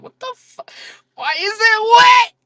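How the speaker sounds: high-pitched horrified shriek, played up; imitating a grossed-out customer screaming; mock scream. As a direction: start high, then burst into a loud squeal. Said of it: "you gonna scream at me".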